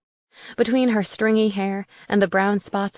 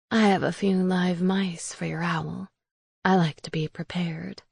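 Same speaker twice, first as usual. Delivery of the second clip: whispering witch. Acted. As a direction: whisper like a creepy old hag. Witch Raisa speaks.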